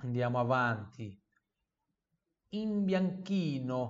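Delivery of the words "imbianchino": imbianchino slow, chi said as ki; slow model word. Shown as a demonstration. Teacher modelling imbianchino before the repeat. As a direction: say imbianchino slowly, ki not chi. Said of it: "repita em voz alta".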